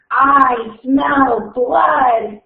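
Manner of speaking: very slow, rising menace. Acted. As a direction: snarl it out slowly, hungrier. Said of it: "he heard the voice".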